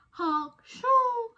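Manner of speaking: a loud, high honking snore then a whistling shoo; cartoon snore, honk and shoo; snore imitation. Shown as a demonstration. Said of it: "Are you more of a honk shoo".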